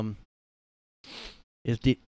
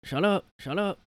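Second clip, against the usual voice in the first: mock angry Biden; 9 semitones higher than usual. Biden impression.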